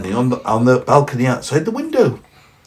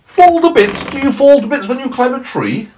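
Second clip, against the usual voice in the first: high, loud cheery snowman; 8 dB louder than usual. Acted. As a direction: play the indignant snowman, high and bright. Snowman voiced.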